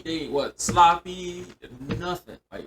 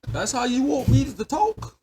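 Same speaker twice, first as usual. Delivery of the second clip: mock indignant challenge; 12 semitones higher, 7 dB louder than usual. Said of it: "I said".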